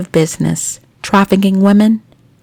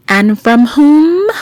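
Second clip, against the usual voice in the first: dramatic question; 7 semitones higher, 4 dB louder, slower than usual. Acted.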